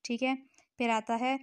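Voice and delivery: ordinary talk, nothing marked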